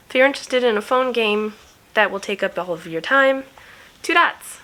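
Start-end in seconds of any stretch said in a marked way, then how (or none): 4.0-4.6 excited. Real, not acted. Punchline game name.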